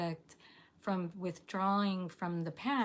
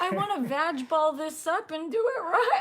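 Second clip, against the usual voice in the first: laughing; 8 semitones higher, 6 dB louder than usual. Real, not acted. Laughing through tears.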